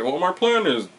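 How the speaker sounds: mock metallic villain delivery; Bane's voice. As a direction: intone it as a machine-voiced villain. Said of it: "saying it with his big, like, metal machine voice".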